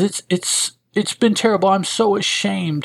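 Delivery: high-pitched, halting acted confession; ashamed woman. Woman confesses her condition to collector, high-pitched acted shame. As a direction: voice a woman stumbling into an embarrassed admission.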